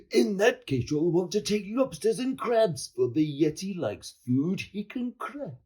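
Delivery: high-pitched creature voice; monster voice. A narrator's reading. Monster Nettie offers George food for Yeti, high monster voice.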